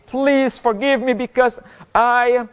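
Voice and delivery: high, drawn-out plea; imploring God for mercy; pleading prayer. Acted.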